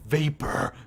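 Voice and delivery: slow, soft, low storyteller; hushed list building a misty scene; atmospheric narration. Acted. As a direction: draw it out mysteriously. Reads the misty cave scene.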